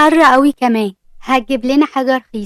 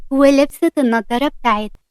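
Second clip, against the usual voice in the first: ordinary delivery, nothing marked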